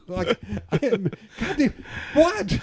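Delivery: high, half-laughing bewilderment; baffled, pleading for an answer; laughing exasperation. Real, not acted. Telling the host about the mix-up, laughing exasperation. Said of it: "exasperated comment".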